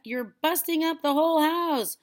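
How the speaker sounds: high, loud alarmed shout; calling back. A narrator's reading. Boy pleading grandma to stop, calling back. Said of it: "George called back".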